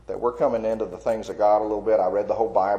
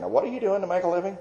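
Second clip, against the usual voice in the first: high, quick, worried parental question; my parents pressing me, asking how I'll make a living: high, quick worried question; 10 semitones higher, faster than usual. Retold or imitated. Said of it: "my parents are saying".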